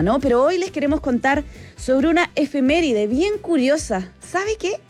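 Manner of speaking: usual speaking voice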